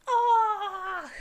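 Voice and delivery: high, slow cry of joy; joyful cry. Real, not acted. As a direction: let out an overwhelmed ah. Overwhelmed by joy.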